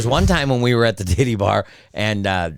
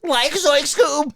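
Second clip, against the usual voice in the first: very high, drawn-out cartoon sidekick voice; Scooby-Doo cartoon impression; much slower than usual. Shown as a demonstration. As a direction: squeak it out like a startled cartoon slacker. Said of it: "I do impressions mainly".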